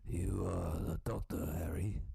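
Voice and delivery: imitated Korean accent, measured pace; Korean Hagrid. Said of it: "I'm just doing an impression of my grandfather".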